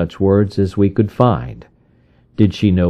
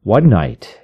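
plain reading voice

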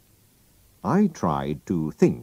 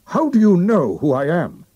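startled, much higher and louder; shocked outcry; 13 semitones higher, 7 dB louder than usual. Acted. The king, unmasked by Holmes, cries out in shock. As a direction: blurt it out, caught off guard. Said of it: "he cried".

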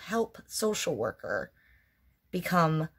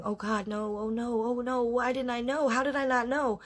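retold crying; 5 semitones higher than usual. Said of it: "started crying and going".

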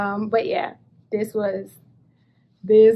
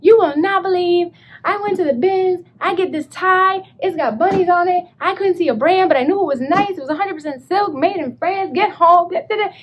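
higher, louder breathless gush; excited retelling; 7 semitones higher, 5 dB louder than usual. Retold or imitated. Calling friend Teresa late, gushing excitedly. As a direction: pour it out to a friend. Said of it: "I was like".

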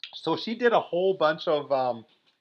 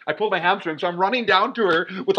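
ordinary delivery

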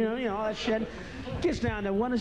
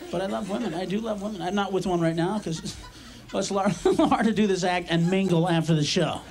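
ordinary talk

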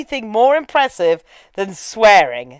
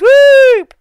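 loud, very high swooping whoop; cartoon whoop; 13 semitones higher, 8 dB louder than usual. Host voices demon leaping into fetus, silly whoop. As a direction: whoop like a demon zipping away. Imitates demon jumping into baby.

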